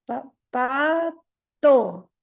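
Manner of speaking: stuck on "pa", repeats "pa, pa" then slowly forces out "pato"; a child with apraxia straining to get out "pa, pa, pato"; halting effortful syllables. Shown as a demonstration. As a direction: struggle from syllable to syllable, very slow. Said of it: "puede que diga pa".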